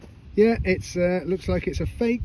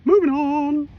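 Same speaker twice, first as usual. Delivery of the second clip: sing-song catchphrase; 10 semitones higher, 3 dB louder than usual. Acted. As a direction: croon it like a little jingle. Catchphrase before the next hole.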